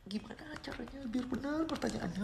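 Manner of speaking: soft whispered aside. Real, not acted. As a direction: whisper it under your breath. Muttering aside to herself.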